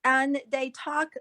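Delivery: ordinary delivery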